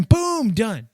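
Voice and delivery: boom, loud and high, like a win; sudden high loud boom; excited boom. Retold or imitated. Pretend sale lands.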